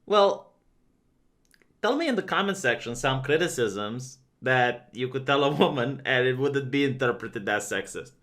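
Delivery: ordinary talk, nothing marked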